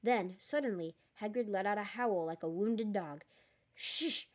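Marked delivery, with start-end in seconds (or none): 3.7-4.3 sharp unvoiced hiss; hissed shush. A narrator's reading. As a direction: hush them with a hiss. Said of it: "hissed Professor McGonagall".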